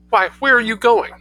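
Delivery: startled mother. Acted.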